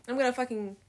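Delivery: usual speaking voice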